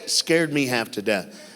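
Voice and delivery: ordinary talk, nothing marked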